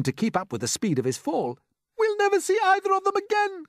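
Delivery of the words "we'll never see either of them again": very high, loud wail; distraught cry. A narrator's reading. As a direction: wail as if all is lost. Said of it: "cried the ladybird".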